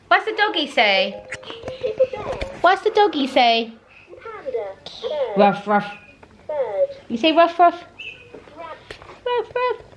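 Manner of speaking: put-on high, bouncy voice for a toddler; sing-song baby talk. Mum reading a talking book to her baby, high and sing-song. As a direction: coax the baby through the picture book.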